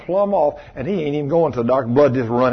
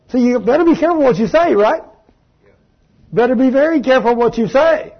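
preaching warning; 9 semitones higher, 3 dB louder than usual. Acted. Closing warning.

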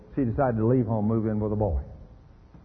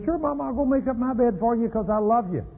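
put-on sweet little boy; 8 semitones higher than usual. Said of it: "He says".

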